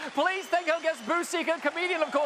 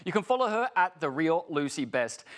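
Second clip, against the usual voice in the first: ordinary talk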